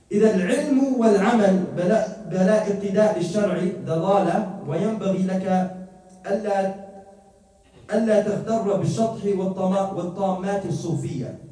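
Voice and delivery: quick, loud recitation of the Arabic text; recited Arabic quote. Acted. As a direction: rattle off the classical passage with force. Reads Arabic text aloud.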